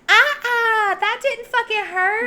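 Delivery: high, whimpering mock bravado; imitating hurt killer. Stabbed killer insisting he's fine, mock whimper. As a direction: squeal it in put-on pain. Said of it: "But he's like".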